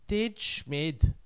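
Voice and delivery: dismissive sing-song character voice. Acted.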